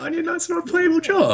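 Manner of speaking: squeaky high-pitched objection; high mock protest. Mock protest in game debate.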